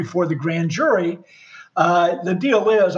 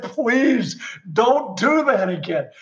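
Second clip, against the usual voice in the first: slow, higher-pitched retold plea; begging; 4 semitones higher, slower than usual. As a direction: drag out a pleading request, imitating a desperate lawyer. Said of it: "my attorney was begging me".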